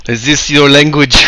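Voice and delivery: lightly put-on Terminator accent; stilted question in a mild Terminator accent. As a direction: ask with a hint of Terminator accent. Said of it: "kind of a Terminator-esque looking face".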